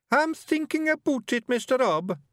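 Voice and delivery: very high-pitched Scots laddie; squeaky-high character voice; young Scottish Feegle. Acted. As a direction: play an earnest wee young bard. Billy protests to Rob.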